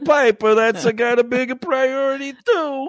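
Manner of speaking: high, bouncy hammed-up plumber voice; comic plumber character. Host clowning about plumbing for co-host, silly character voice.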